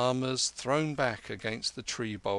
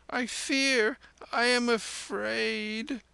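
put-on very high, drawn-out child's cry; frightened child's wail; 12 semitones higher, slower than usual. Scared child crying at two old men, high roaring wail. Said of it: "roared the child".

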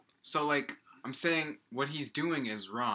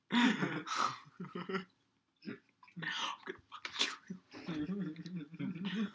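whispered threat; 6 semitones higher, 5 dB quieter, slower than usual. Pretend. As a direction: whisper a mock death threat. Mock threat after bad pun.

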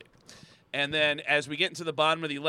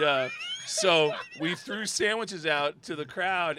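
ordinary delivery

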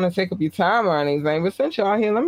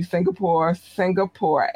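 ordinary talk, nothing marked

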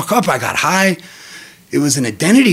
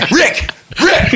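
calling out; 12 semitones higher than usual. Real, not acted. Calling out laughing.

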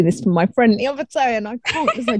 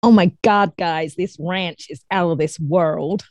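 put-on American accent, drawn out and lower; best American accent; 4 semitones lower, slower than usual. Shown as a demonstration. Guest performs one sentence on request, put-on American accent. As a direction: do your best American, stretching the words. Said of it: "in your best American accent".

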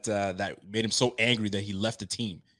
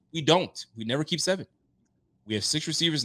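ordinary delivery

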